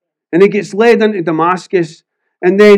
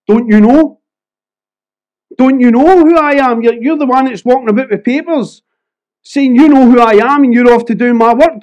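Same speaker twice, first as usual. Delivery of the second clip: put-on higher, forceful Edinburgh accent, confronting Saul; raised, challenging voice in an Edinburgh accent; 4 semitones higher, 3 dB louder than usual. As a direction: slip into an Edinburgh accent as an indignant Jesus. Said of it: "I'd went into an Edinburgh".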